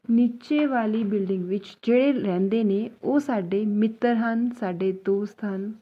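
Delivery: slow, careful Punjabi sentence; slow teaching demo. Shown as a demonstration. Teacher slowly models a Punjabi sentence for students. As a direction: spell it out word by word for learners. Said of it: "इस तरह से".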